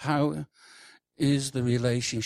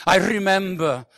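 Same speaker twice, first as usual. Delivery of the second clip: high, booming declaration; loud, hammed-up reminiscing; loud testimony; 7 semitones higher, 11 dB louder than usual. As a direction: proclaim it like a proud testimony. Acts out a testimony, retaking it.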